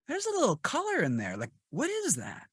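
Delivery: high-pitched, wondering reaction; intrigued puzzlement. Retold or imitated. Host recalling the accent catching his ear, intrigued.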